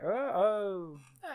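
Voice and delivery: rising "oh, oh", fading to quiet; the moment you realise you're speeding; mock surprise; dawning alarm. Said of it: "you go like".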